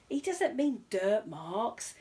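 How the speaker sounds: slow, patient show host; lightly voiced host. Host corrects the bear, slow and patient. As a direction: explain it slowly to the bear. Ronnie Playfair explains.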